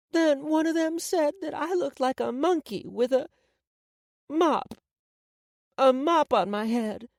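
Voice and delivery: put-on high-pitched child voice; young girl confiding a hurt, pitched high; upset little girl.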